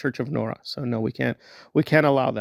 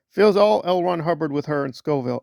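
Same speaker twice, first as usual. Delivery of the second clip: ordinary delivery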